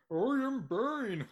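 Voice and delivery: high, slow, hammy Bane voice; Bane impression. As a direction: imitate Tom Hardy's Bane through the mask. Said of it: "Tom Hardy's Bane is like".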